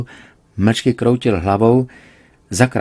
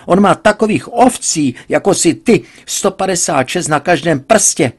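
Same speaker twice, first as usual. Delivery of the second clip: loud, much higher rant; father ranting at his daughter about the Indian; 9 semitones higher, 6 dB louder than usual. A narrator's reading. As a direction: scold the foolish daughter at full pitch.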